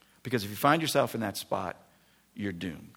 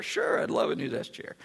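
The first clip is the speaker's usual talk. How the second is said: high eager. Retold or imitated. Eager reply, pitched up.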